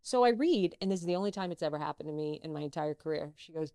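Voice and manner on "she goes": ordinary talk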